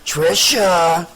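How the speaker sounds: hissing monster calling her name; deep distorted hiss. Acted. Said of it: "a deep, distorted voice hissed".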